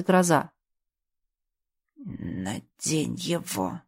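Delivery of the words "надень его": low, slow, strained command squeezed out; forced out. Acted. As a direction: push the words out with effort. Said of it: "Через силу выдавил Аларт".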